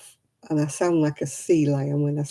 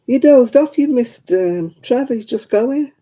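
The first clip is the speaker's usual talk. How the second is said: higher, louder call to rouse someone; 7 semitones higher, 7 dB louder than usual. Real, not acted. Calling out to Miguel who dozed off, loud call. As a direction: raise the voice to wake him up.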